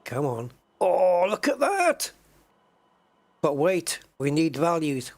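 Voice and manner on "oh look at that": excited. Real, not acted. Data suddenly appears, delighted.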